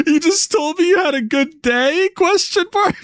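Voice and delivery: high, incredulous mock shout; all-caps disbelief. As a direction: read the all-caps text as shock. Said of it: "Kirby said in all caps".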